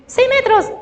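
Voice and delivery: put-on high, chirpy chorus of kids; lightly voiced children answering. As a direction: answer like eager little children. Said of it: "los niños van a decir".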